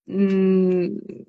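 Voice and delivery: ordinary delivery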